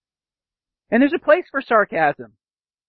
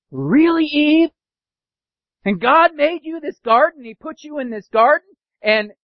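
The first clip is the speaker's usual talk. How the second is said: higher, mocking disbelief; sarcastic Satan; 7 semitones higher than usual. Preacher playing Satan questioning Eve about the garden, sarcastic. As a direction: taunt her as if God's rule were a joke. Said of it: "Satan is being sarcastic".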